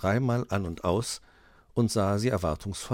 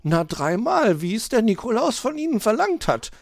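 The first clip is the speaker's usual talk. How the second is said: high, louder magpie answer; 7 dB louder than usual. Acted.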